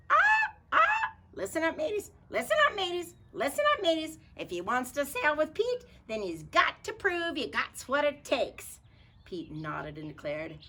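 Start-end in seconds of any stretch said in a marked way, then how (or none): 0.1-8.8 hearty pirate captain. Acted. Pete calls rascals.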